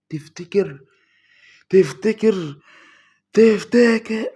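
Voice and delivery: from normal to high, loud and slow; rising tension, stretch the last one; building suspense. Real, not acted. Gamer teasing viewers before the reveal, building loud.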